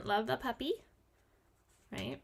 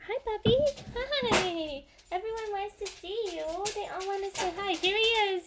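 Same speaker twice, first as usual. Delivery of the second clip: pet talk; 9 semitones higher, 4 dB louder than usual. Real, not acted. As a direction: coo at the dog in a squeaky voice. Showing the dog to viewers.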